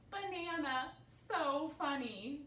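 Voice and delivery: loud, high, drawn-out amusement; highly amused. A narrator's reading. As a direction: savour the silly joke loudly. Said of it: "They think it is so funny".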